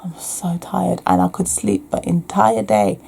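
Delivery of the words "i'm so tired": drained, whispered moan; exhausted whisper. Retold or imitated. Imitating herself on chemo days, in a tired whisper. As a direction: barely breathe it out, wiped out. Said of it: "I was just like".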